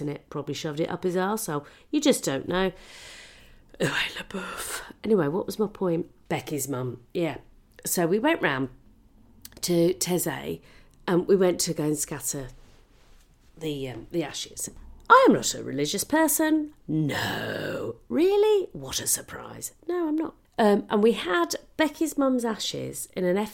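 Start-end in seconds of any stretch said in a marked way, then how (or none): 3.8-4.8 breathy whispered où est le bœuf; whispered callback. Pretend. Comic whispering the running joke to the audience. 18.1-18.7 mock surprise. 18.7-19.7 whispered dry aside; whispered sarcasm. Pretend. Comic whispering a sarcastic aside to herself.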